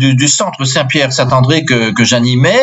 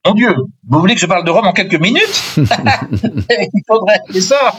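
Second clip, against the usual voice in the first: laughing; 6 semitones higher than usual. Real, not acted. Host asks about Rome briefly.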